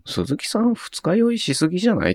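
高い声で軽く突っ込む; ツッコミ調で、声を高く; からかう声. Retold or imitated. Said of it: "って思ってた". (高い声でからかうように)

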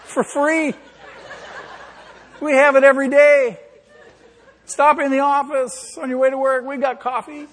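high, laughing sales pitch; laughing invitation. Acted. Pastor jokingly pitching free church coffee, high and laughing.

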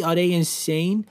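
ordinary talk, nothing marked

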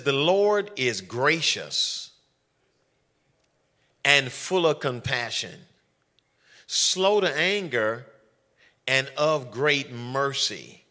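slow, solemn reading of the verse; scripture reading. Acted. Preacher reading the psalm to his congregation, slow and solemn. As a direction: lay each phrase down slowly for the church.

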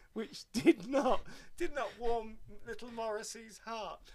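laughing. Real, not acted. Ironic punchline, laughing.

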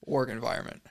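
ordinary talk

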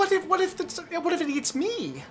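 high, squeaky fretting; worried halfling. Acted. Halfling asked to mind a dire wolf, high-pitched panic. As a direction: stammer it out in a high, frightened voice. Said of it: "this halfling man, uh, looks at you".

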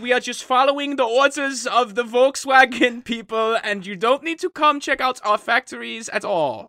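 bad German accent, high and loud. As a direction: ham up a German executive. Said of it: "do a German accent".

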